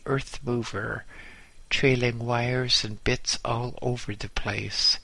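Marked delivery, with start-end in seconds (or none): none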